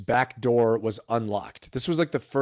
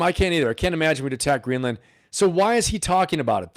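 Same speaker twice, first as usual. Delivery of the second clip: usual speaking voice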